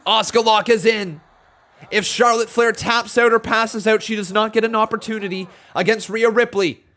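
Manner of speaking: lively ringside match call; live play-by-play. Acted. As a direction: call the action as it happens.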